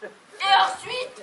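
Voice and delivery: slow, high, loud leading question; pointed prompting. Acted. Wife quizzes husband on what follows, pointed. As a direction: stretch it out to make him think.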